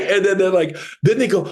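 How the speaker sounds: ordinary talk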